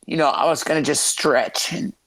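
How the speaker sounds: normal talking voice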